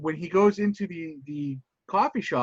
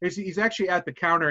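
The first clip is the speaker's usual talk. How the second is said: usual speaking voice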